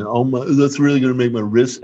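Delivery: a hint of a mocking whine; mock a petulant whiner; whiny voice. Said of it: "in that whiny voice".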